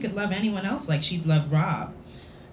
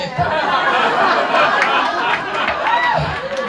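big high burst of laughter; loud real laughter; 8 dB louder than usual. Real, not acted. Reader laughs hard.

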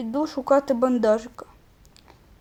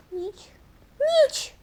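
squeaky alarm; 12 semitones higher than usual. Acted. Gamer panics as night comes.

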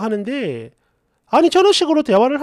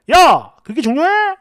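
higher, louder, drawn-out imitation of a snapping boyfriend; slow, exaggerated indignant retort; mock angry outburst; 4 semitones higher, 5 dB louder, slower than usual. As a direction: imitate someone set off by a trigger. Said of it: "이렇게 화를 내는 거".

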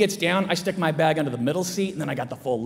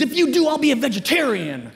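mock defiant kid; 6 semitones higher, 4 dB louder than usual. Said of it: "I was in a big fight with my mom".

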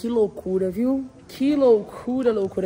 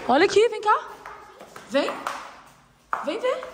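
higher eager calling; excited calling; 8 semitones higher than usual. Real, not acted. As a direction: urge them to come look. Calling friends over.

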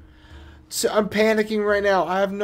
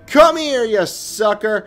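taunting call; 2 semitones higher, 7 dB louder than usual. Real, not acted. Taunting the enemy to the cage.

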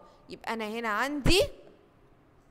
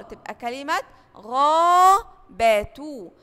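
غابة stretched slowly and louder; drawn-out long vowel; 8 dB louder, much slower than usual. Shown as a demonstration. Teacher praising pupils, then stretching the word. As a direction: draw out the aa in غابة. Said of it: "شطار".

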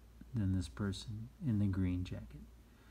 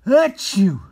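big, high stage sneeze; mock sneeze; 11 semitones higher, 18 dB louder than usual. Teacher acting out an unexpected sneeze, mock sneeze. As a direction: burst out a loud pretend achoo. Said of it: "or you sneeze".

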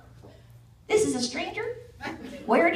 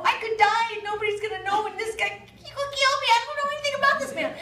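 panicky; 8 semitones higher, faster than usual. Retold or imitated. Panicked thoughts on the sled.

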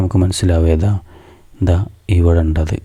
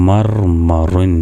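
slow blending; much slower than usual. Shown as a demonstration. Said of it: "അപ്പോൾ എങ്ങനെയാവും?".

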